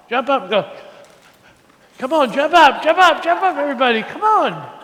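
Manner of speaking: loud, high, urgent cheerleading; excited rallying. Real, not acted. Pastor getting children to jump up, excited rallying.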